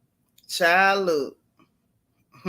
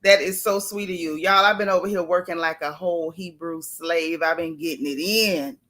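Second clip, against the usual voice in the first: ordinary talk, nothing marked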